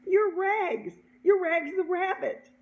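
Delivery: high, loud exclamation; excited recognition. Retold or imitated. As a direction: announce your discovery with excitement. Cashier exclaims his recognition.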